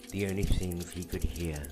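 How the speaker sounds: ordinary delivery, nothing marked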